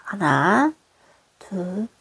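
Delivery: usual speaking voice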